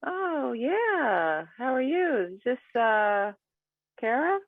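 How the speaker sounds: a bright high put-on voice. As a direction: answer up high and sweet. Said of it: "the last one with the high voice".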